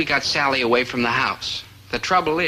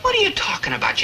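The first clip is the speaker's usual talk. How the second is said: stunned protest. Acted. Dobie stunned by accusation.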